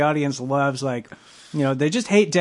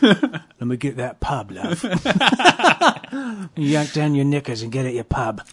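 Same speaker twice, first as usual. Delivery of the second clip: put-on British accent; 4 dB louder than usual. Mock British guy in a pub.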